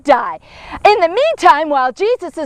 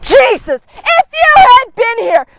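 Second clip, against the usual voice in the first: higher, louder accusing outburst; letting him have it; 5 semitones higher, 5 dB louder than usual. Acted. Grieving Mary confronting Jesus, higher and louder in anger. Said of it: "she just lets Jesus have it".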